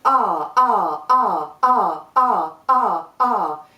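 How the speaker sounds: loud punchy R bursts from the diaphragm; short shouted vowel pulses; kicked R sounds. Shown as a demonstration. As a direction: kick each R out hard. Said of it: "So we're gonna go".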